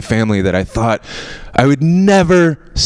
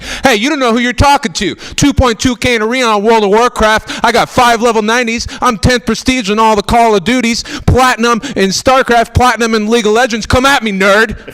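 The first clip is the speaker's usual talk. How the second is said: angry gamer brag; 10 semitones higher than usual. Retold or imitated. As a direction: puff up and rant your stats at them. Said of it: "I would get angry".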